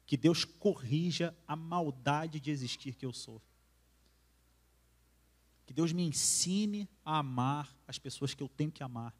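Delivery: slow, earnest prayer voice; slow solemn sermon prayer. Acted. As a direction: pray it slowly, from the heart. Preacher prays aloud.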